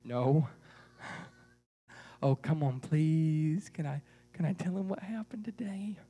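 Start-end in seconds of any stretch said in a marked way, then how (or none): 3.7-6.0 whispered plea into the puppet's ear. Acted. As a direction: hush it, just between the two of you.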